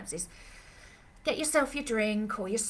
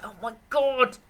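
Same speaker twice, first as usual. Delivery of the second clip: exasperated; 5 semitones higher, 7 dB louder than usual. Retold or imitated. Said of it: "we're just like".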